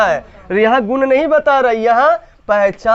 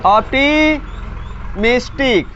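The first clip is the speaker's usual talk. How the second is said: Optimistic drawn out in two parts; slow, split word; much slower than usual. Shown as a demonstration. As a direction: stretch the term, pausing midway for learners. Breaks the term up for class.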